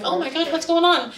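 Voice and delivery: high-pitched, mildly alarmed question; alarmed friend. Retold or imitated. Friend pokes her head out of the bathroom, alarmed question.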